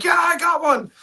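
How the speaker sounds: high, thrilled yeah; squealing with delight; excited find. Retold or imitated. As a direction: gloat over the lucky find. Finding the chase car.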